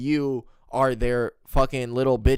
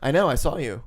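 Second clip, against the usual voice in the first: normal talking voice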